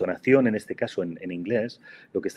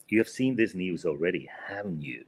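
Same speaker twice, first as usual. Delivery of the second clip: "haven't you" falling and softer, seeking agreement; falling tag; 3 semitones lower, 3 dB quieter than usual. Shown as a demonstration. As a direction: drop the tone on "haven't you", just confirming. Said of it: "just falling intonation".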